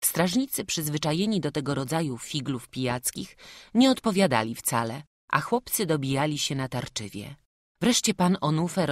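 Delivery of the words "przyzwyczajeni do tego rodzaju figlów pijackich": plain reading voice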